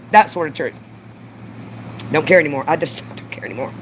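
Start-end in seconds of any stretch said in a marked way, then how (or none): none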